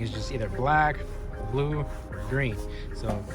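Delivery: ordinary talk, nothing marked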